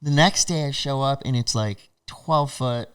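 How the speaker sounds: ordinary talk, nothing marked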